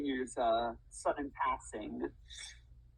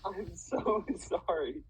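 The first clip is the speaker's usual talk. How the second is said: slow, higher, laughing through it; laughing apology; 4 semitones higher, slower than usual. Real, not acted. Lawyer apologising to client mid-trial, laughing apology. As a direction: try to apologise while cracking up. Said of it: "I shouldn't be laughing".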